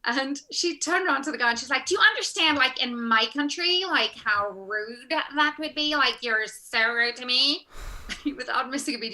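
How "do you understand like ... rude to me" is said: obnoxious Californian actress. Retold or imitated. Actress scolds Romanian man for rudeness, obnoxious Californian. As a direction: imitate an entitled American having a hissy fit. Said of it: "really obnoxious and".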